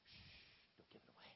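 hushed secretive whisper. Acted. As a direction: whisper it so nobody hears. Samson hushing the riddle secret.

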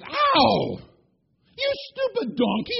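loud, high yelp turning to scolding; hammed-up hurt and fury; pained angry yell. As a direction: yelp in pain, then scold the donkey loudly. Foot crushed, Balaam yells at donkey.

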